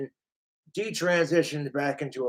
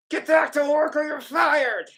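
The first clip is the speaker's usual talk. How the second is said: high-pitched angry cartoon boss; shouted threat from a fed-up manager; Benson; 9 semitones higher than usual. Boss orders staff back to work, high angry shout. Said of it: "your Benson was a little off".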